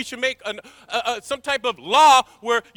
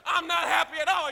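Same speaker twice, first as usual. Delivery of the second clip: usual speaking voice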